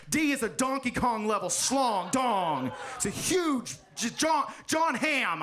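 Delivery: building, drawn-out excitement, rising high; hammed-up amazement, stretched out; mock awe. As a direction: get carried away and holler. Carried away describing the top grade.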